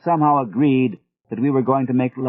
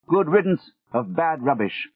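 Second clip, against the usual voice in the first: put-on Gregory's British accent; 6 semitones higher than usual. A narrator's reading. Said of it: "in that British accent of his".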